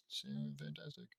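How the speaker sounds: hushed, whispered delivery; very soft, barely audible whisper. Acted. Reader slips in an aside about the coat, whispered. Murmured aside during the reading.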